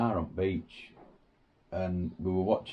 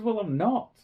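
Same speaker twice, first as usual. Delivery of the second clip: sharp comeback; 11 semitones higher, 4 dB louder than usual. Retold or imitated. Snapping back at tourist.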